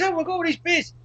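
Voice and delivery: high, eager shopkeeper voice; imitating the deli bloke. Retelling the deli owner boasting about beers, high and eager. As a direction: send up the bloke's enthusiasm. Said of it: "then he goes".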